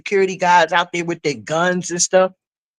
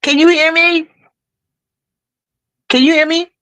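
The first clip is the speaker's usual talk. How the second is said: louder and higher, checking the line; raised, asking louder; 10 semitones higher than usual. Real, not acted. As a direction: call out to be heard. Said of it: "You must got a lag".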